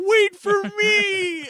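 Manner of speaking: high, loud drawn-out yell; desperate shout. Retold or imitated. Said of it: "He's like".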